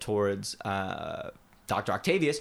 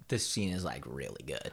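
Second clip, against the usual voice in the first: hushed; 9 semitones lower, 8 dB quieter than usual. Retold or imitated. Imitating friend hushed enthusing.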